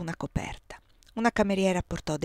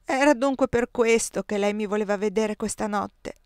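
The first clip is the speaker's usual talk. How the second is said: ordinary delivery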